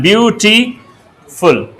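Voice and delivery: Beautiful stretched slowly syllable by syllable; beautiful spelled out slowly for students. Shown as a demonstration. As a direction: draw out beautiful so each of its three syllables is heard. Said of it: "अब इसमें तीन syllable हैं".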